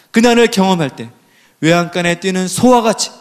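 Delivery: ordinary talk, nothing marked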